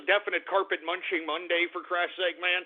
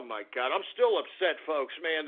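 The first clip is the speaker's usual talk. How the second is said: ordinary talk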